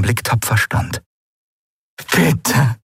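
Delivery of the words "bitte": higher, imploring single word; pleading. A narrator's reading. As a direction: beg them to listen. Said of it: "flehte sie".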